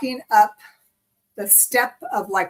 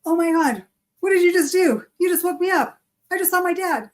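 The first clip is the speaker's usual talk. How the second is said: high, raised voice, reliving waking from the dream; retold upset excitement; 8 semitones higher, 3 dB louder than usual. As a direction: burst out at being woken from seeing Dad. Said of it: "I just woke up and I'm like".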